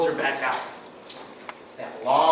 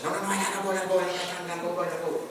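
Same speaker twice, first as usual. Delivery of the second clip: hurried Squirrel; 4 semitones higher, 4 dB quieter, much faster than usual. Acted.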